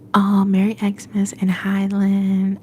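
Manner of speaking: normal talking voice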